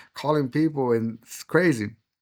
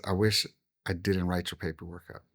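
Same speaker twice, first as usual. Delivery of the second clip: low, hushed quoted regret; quietly regretful; 6 semitones lower, 8 dB quieter than usual. Retold or imitated. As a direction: voice an officer's apology, soft and low. Officer regretfully confides after the prayer.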